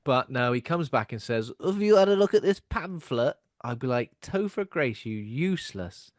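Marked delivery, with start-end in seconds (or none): 1.6-3.4 high, louder chirpy doorstep missionary voice; pushy missionary. Retold or imitated. Voicing the elder at door. 4.2-6.1 mock scolding. Mock insulting the character.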